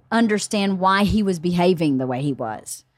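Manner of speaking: plain reading voice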